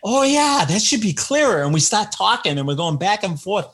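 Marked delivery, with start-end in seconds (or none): 0.1-1.6 George conceding. Retold or imitated. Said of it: "And George is like".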